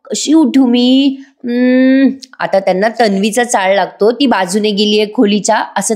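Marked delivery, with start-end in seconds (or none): 0.0-1.2 put-on higher, slow pained old voice; old woman in back pain. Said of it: "Purnaji आता म्हणतात". 1.2-2.1 short high groan of pain; pained old woman's groan. Retold or imitated. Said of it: "Purnaji आता म्हणतात".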